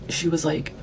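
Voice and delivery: ordinary talk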